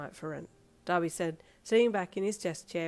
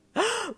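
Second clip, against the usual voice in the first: high sharp gasp; 6 dB louder than usual. Acted. Joyful reveal.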